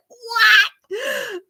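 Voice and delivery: high, loud shriek of disbelief; mock-astonished high shout; shocked shout. Retold reaction to surprise news.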